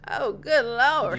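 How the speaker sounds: slow Southern drawl. Retold or imitated. Repeating the theater lady's reaction, Southern drawl. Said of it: "The, the drawl".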